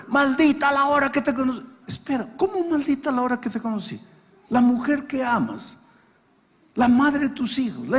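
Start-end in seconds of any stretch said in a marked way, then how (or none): 0.1-1.6 a husband's bitter, higher-pitched curse at his wife. Retold or imitated. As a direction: spit out the regret, mildly acted. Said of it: "por qué un hombre mira a la esposa".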